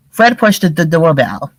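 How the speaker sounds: usual speaking voice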